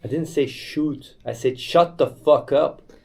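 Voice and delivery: usual speaking voice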